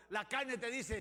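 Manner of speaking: normal talking voice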